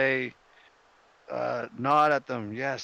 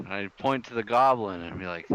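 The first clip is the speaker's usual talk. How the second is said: normal talking voice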